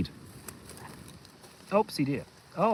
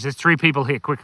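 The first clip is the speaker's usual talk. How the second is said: ordinary talk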